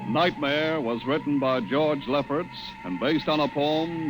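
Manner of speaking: ordinary delivery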